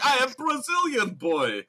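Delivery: high, loud hammed-up Russian, amused; mock Russian accent. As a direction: insist in a comically thick Russian accent. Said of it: "a Russian accent".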